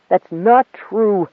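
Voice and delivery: slow, insistent, frantic denial; wild denial. Acted. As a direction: refuse to accept it, fiercely. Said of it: "his voice suddenly wild with denial".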